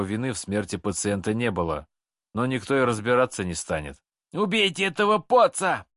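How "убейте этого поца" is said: shrieking yell. Acted. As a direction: scream it, breaking into a squeal. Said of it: "истошно проорал Шамес, переходя на визг".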